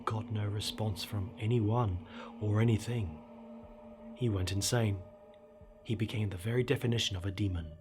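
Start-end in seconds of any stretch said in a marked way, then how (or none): none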